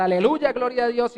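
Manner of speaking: alabanza gritada, más alta y fuerte; gritar aleluya. Retold or imitated. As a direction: grita de júbilo en la iglesia. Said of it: "y gritar". (grito de alabanza, alto y fuerte)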